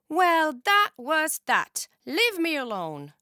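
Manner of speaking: a loud angry sulk; angry huff. A narrator's reading. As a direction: push everyone away. Summer angry after soaking.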